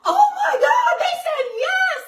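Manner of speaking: very high, loud joyful shriek; overjoyed squeal through laughter; excited screaming. Retold or imitated. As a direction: scream the good news, half crying. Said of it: "I'm freaking out and I'm screaming".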